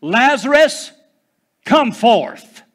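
commanding shout, pitched high and loud; Jesus shouting into the grave; loud cry. Acted. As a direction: call the dead man out of the tomb. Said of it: "Jesus cried with a loud voice".

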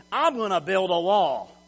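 loud, imitated politician's boast; confident boast. Preacher mimicking the president's wall promise, loud and confident. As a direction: announce it loudly with full confidence. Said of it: "say that with confidence".

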